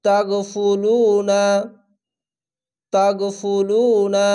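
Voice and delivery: ইউসল্লু slow, the vowel held one beat; one-beat stretch. Shown as a demonstration. Teacher reciting for the class, slow stretched reading. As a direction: draw out ইউসল্লু slowly. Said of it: "এক আলিফ টেনে পড়বেন".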